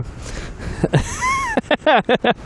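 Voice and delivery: high-pitched burst of laughter. Real, not acted. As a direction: squeal with laughter. Laughs after chanting.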